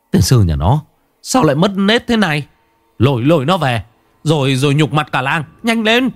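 furious villager yelling; angry scolding. A narrator's reading. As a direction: berate him and order him dragged off. Villager curses the drunkard.

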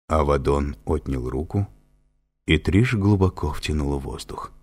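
usual speaking voice

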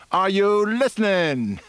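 high, slow drawn-out radio call; radio announcer call. Retold or imitated. Imitating the radio host calling to his listeners, slow and high. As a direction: croon it like the AFN host.

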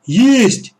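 loud, high cry of discovery; excited shout. A narrator's reading. Boy's shovel team hits treasure, excited shouting. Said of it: "закричал Боря".

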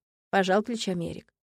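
ordinary delivery, nothing marked

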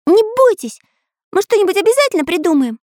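bright little hero reassuring friends; Pencil character voice. A narrator's reading. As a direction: Pencil calming friends in the dark, high and quick. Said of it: "почесал затылок Карандаш".

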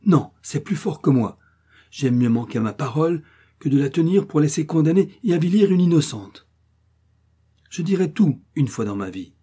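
can't hold it in any longer; impatient outburst. A narrator's reading. Old woman losing patience with the family, impatient outburst. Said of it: "comme en s'impatientant".

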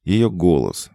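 ordinary delivery, nothing marked

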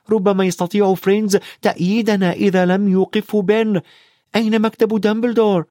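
put-on high-pitched boy's voice, pressing; earnest high child character voice; Harry, urgent. A narrator's reading. Harry urges his friends.

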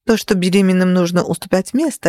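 usual speaking voice

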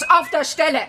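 high, outraged command; furious order. Acted. Teacher ordering the father out of her house, high fury. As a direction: order him out, shrill with rage.

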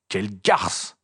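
brief indignant outburst; exclaimed insult. A narrator's reading. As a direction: exclaim it with mild outrage. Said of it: "s'écria Ron". (exclamation indignée)

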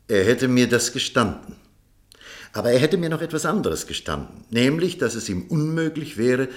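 ordinary talk, nothing marked

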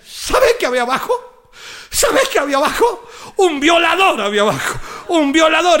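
building up to "un violador"; wife telling her husband what was downstairs. Retold or imitated. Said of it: "le dice la mujer".

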